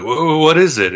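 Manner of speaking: put-on a young boy hearing a big secret; awed surprise. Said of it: "young Alexander's like".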